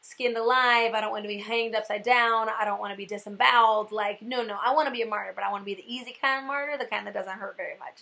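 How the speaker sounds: mocking myself, bargaining with Jesus over an easy death. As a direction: haggle over the kind of death. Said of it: "And then I'm like".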